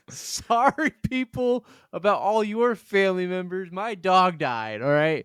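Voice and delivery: high, flippant apology to the dead; mock callous apology. Joking to a friend about wishing back the dog, high flippant sorry. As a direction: brush off the dead like they don't matter.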